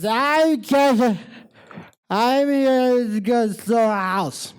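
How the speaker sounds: put-on very drunk, high slurred holler. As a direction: slur it slow and high like a sloppy drunk. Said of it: "four sheets to the wind".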